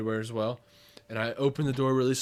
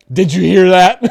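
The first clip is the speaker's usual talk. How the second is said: much louder, higher alarmed question; urgent question; 6 semitones higher, 18 dB louder than usual. Retold or imitated. Waking his wife over a noise, loud alarmed. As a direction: loom over her and demand it. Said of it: "That's what my wife saw".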